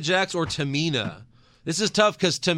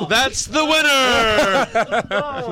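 loud, drawn-out victory shout; excited shout; 10 semitones higher, 5 dB louder, much slower than usual. Real, not acted. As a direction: announce the winner with glee. Excited winner announcement.

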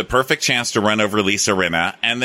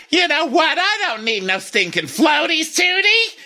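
very high, put-on defiant voice; hammed-up squawk of refusal; Missus Garrett. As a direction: imitate a feisty matron, pitched way up. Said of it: "Missus Garrett's like".

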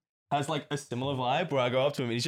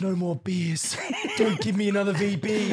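high mock pleading through laughter; hammed-up refusal of another drink; imitated drunk friend; 6 semitones higher than usual. Drunk friend begging off another beer, mock pleading.

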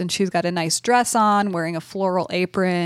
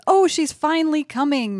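excited dad; 8 semitones higher than usual. Retold or imitated. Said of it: "Dad says".

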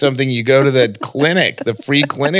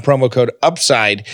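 ordinary delivery

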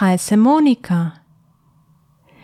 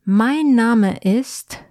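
"Mein Name ist" said slowly and clearly; slow model phrase; slower than usual. Shown as a demonstration. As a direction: spell it out slowly for a learner. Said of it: "Na to pytanie odpowiemy". (powoli i wyraźnie "Mein Name ist")

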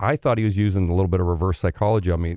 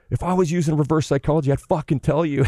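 mock angry coach; 6 semitones higher than usual. Coach's punchline retort.

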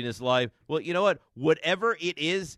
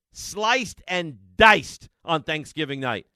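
slow and louder, each word hit; slow emphatic repeat; 6 dB louder, much slower than usual. Real, not acted. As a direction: lean hard on "diced". Host repeats phrase for emphasis.

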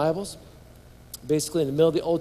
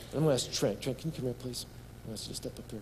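ordinary talk

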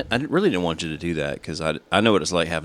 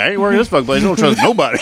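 mock paranoid rant; 8 semitones higher, 6 dB louder, faster than usual. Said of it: "I'm like".

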